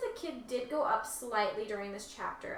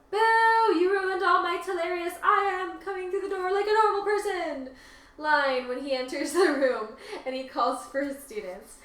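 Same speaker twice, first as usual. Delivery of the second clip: playful boo, then the quoted line half-sung, higher and louder; mock booing; 8 semitones higher, 7 dB louder than usual. A listener teases the author over a missing joke, sing-song mock boo.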